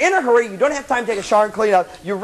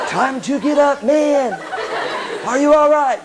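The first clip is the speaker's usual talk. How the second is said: easygoing, higher wake-up call; friendly alarm voice; 5 semitones higher, slower than usual. Acted. Imagined pretty alarm waking a sleeper, friendly. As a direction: check on the sleeper like a mellow buddy. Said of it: "alarm clocks that go like".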